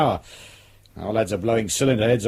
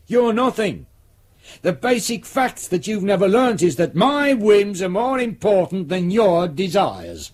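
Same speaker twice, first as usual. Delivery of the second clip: higher and rising in scorn; contemptuous rant; 6 semitones higher than usual. Acted. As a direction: belittle him from above. Superintendent berating the sergeant.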